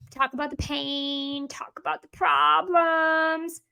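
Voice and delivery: slow, drawn-out mock gloom; mocking parroting. Coach mimicking what the industry trained, mocking drawl. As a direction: drag each phrase out, mocking the advice.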